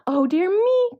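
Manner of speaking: loud, high alarmed cry; frightened cry. A narrator's reading. Goldilocks seeing the bears, crying out loud and high. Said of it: "cried Goldilocks".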